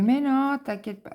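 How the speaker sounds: high mocking husband voice; teasing husband. Husband laughing off his wife's fear, mock reassuring. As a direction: tease her lightly, brushing off her fear. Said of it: "mon mari rigolait".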